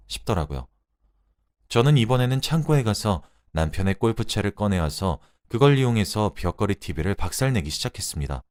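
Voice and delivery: ordinary delivery, nothing marked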